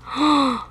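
high audible gasp; delighted gasp. Real, not acted. Gamer finding a character to talk to, excited gasp. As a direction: gasp with anticipation. Amazed by the isle.

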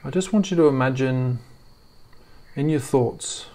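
normal talking voice

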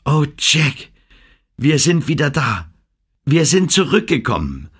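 high, emotional and near tears; cling to him, voice welling up; tearful. A narrator's reading. Ann clinging to Jack after rescue, high and tearful. Ann overwhelmed, then sobs.

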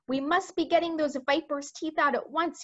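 1 urgent cry. A narrator's reading. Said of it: "he cried".

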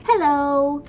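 loud, high hello across the water; shouting a bright hello from the leaf; called out. Acted. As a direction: call it out to the frogs. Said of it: "he cried to the frogs".

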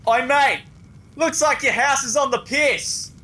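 high, jeering holler; calling out. Acted. Heckling a mate about his tilted house, high holler.

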